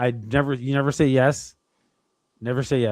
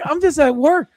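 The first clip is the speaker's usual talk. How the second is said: mock rant; 13 semitones higher, 5 dB louder than usual. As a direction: vent at the scam caller, half laughing. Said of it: "I lost my shit".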